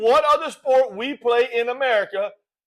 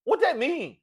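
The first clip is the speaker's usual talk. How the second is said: softer, higher baffled voice; puzzled viewers; 5 semitones higher, 5 dB quieter than usual. Acted. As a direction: act out bewildered viewers asking each other. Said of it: "people are looking at each other".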